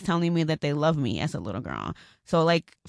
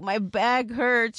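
mock pain; 5 semitones higher, much slower than usual. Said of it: "I'm like".

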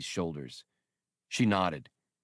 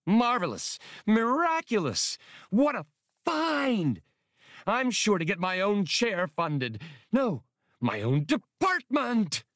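much higher, drawn-out ecstatic cries; delirious joy; 13 semitones higher, 3 dB louder than usual. Acted. Skinks dancing around the vault in giddy excitement. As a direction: crow with giddy delight over the find.

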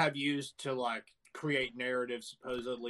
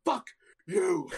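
mock drunk yell; 6 semitones higher, 7 dB louder than usual. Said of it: "and he's like".

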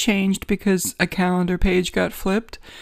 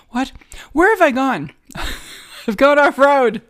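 louder, higher, amused at having strayed; mock dismay; 5 semitones higher, 3 dB louder than usual. Host catching themselves wandering off into religion, mock dismay. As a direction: play up the alarm with a laugh.

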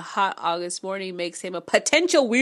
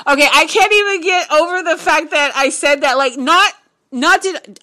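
higher and louder, stunned and giddy; excited disbelief; 7 semitones higher, 8 dB louder than usual. Real, not acted. Reader still reeling that she predicted the line. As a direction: gush in amazed disbelief. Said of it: "rewatch this fucking video".

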